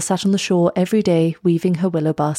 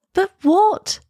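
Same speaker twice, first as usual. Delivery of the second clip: put-on very high, alarmed girl's question; high-pitched anxious demand; urgent cry; 13 semitones higher than usual. A narrator's reading. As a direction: cry out, desperate to hear the rest. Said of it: "cried Elsa".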